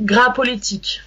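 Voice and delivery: "Gras Politique" with a silent s in "Gras"; native French pronunciation. Shown as a demonstration. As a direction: demonstrate "Gras Politique" in French, "gra po-li-teek". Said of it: "It's pronounced".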